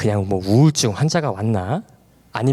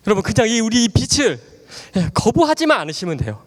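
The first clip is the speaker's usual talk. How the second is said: fervent preaching; 9 semitones higher than usual. Acted. Preacher urging the congregation toward the light, full fervent preaching. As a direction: preach it out to the whole hall.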